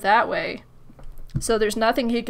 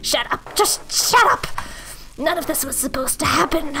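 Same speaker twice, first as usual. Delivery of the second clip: desperate burglar; 3 semitones higher than usual. Acted.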